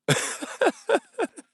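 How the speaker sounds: high, squealing burst of laughter; real laugh at one's own joke. Real, not acted. Streamer cracks up at the prison joke, laughing.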